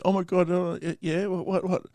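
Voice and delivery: high, stammering frightened imitation; flustered high-voiced retelling; mock panicked. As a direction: imitate a scared driver tripping over her words. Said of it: "She was shitting herself".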